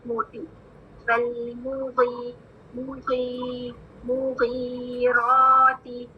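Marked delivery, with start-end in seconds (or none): 1.1-6.1 slow drawn-out recitation of मुगीराती; Quran recitation demo. Shown as a demonstration. Teacher recites slowly for students to copy. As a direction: stretch मुगीराती slowly, each sound held. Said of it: "इसकी sound कैसी होती है".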